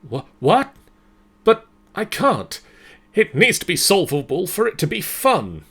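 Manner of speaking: high, loud startled stammer; Isaac blurts out in shock at the voice; startled protest. Acted. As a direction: jump up in pitch, caught off guard. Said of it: "Responding aloud in surprise".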